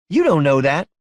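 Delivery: high, loud challenge; upset contradiction. Acted. Wife disputing husband's promise about her mama, upset. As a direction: push back hard, pitch up.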